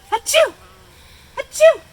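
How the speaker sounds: very loud, very high sneezes; sneezing. A narrator's reading. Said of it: "began to sneeze".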